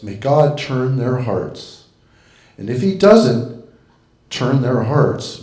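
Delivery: deliberate recital, slow and emphatic; poem reading. Acted. Reciting a comic Irish curse to the room, deliberately. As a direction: recite it with a sly punch at the end.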